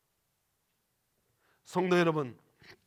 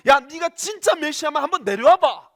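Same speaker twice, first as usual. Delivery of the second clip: loud jeering yell, higher; mocking shout; 7 semitones higher, 12 dB louder than usual. Crowd at the cross jeering Jesus, mocking shout. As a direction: taunt him at full volume from below. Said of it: "소리를 질렀습니다".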